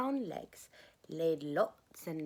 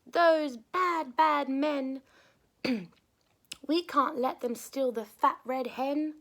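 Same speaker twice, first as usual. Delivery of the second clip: high and loud outraged cats; indignant cats; 5 semitones higher, 8 dB louder than usual. Acted. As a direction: scold the bad men like cross cats. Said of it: "the two cats meowed".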